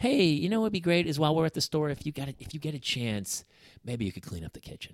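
soft, sweet asking voice that fades away; nicer tone. Retold or imitated. Husband imitating how his wife could ask nicely, soft and sweet. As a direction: coax him gently, easing down. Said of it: "in a nicer tone".

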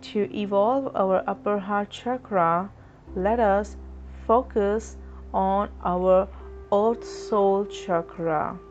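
ordinary delivery

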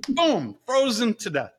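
ordinary delivery

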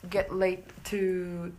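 ordinary delivery

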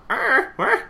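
put-on high, loud comic old-man croak; drawn-out, confused old-timer voice; hard-of-hearing old man. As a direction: play a baffled deaf old codger.

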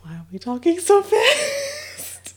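choking after a shot. Real, not acted. Just took a shot, burning.

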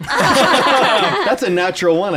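high, slow, laughing it out. Real, not acted. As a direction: laugh through the words. Rolled a natural one.

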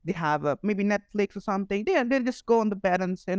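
normal talking voice

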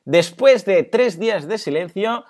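slow, loud, high build-up; dramatic announcement. Acted. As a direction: announce it like big news. Dramatic announcement of client return.

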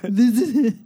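hesitant attempt at an Austrian accent; Arnold Schwarzenegger accent. Asked for her Arnold impression, greeting Californians in an Austrian accent. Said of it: "do your Arnold Schwarzenegger accent".

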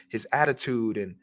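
usual speaking voice

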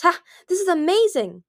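high, loud burst of delight; elated. A narrator's reading. Mind Reader thrilled with her new power, elated. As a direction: crow with joy.

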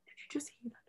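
unvoiced whispered aside; breathy secret whisper, no voice. Whispering to a friend about a stranger, hushed. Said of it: "And I was like".

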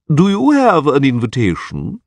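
slow, lofty official voice; haughty chamberlain. Acted. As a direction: look down on them as you ask. Haughty chamberlain addresses intruders.

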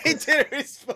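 high, loud echo through laughter; laughing echo. Retold or imitated. Mockingly echoes the other speaker, laughing.

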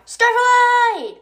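high loud shout of the name Starlight; yelled name. Acted. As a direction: call Starlight across the hall. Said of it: "oh, I said that wrong".

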